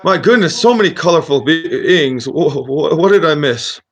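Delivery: put-on high-pitched, flustered old wizard; startled old wizard. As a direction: wake up befuddled and amazed, pitched high. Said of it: "the wizard just stands up".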